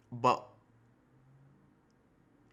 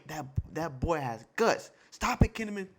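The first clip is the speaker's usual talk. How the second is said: ordinary delivery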